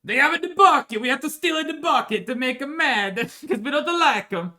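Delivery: high, loud mock Italian rival; jokey Italian accent taunt; hammed-up Italian. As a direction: sneer it like a cartoon Italian villain. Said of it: "that's not Italian".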